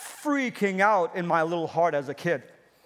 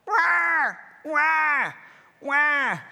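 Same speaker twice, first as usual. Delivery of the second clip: mock baby wail; 6 semitones higher, much slower than usual. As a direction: cry like a hungry baby. Preacher imitates crying baby.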